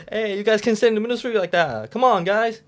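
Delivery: loud, high, fast scolding; yelling stranger. Retold or imitated. Passerby yelling at lost students blocking the street, loud and fast. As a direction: shout them off the road in a rush. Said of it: "yelling at us".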